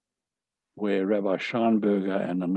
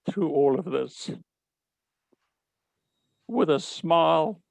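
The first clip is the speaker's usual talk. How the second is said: moved, paying tribute to his wife; emotional; 4 semitones higher than usual. Real, not acted. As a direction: voice lifting higher with real emotion. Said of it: "I'm very emotional about it".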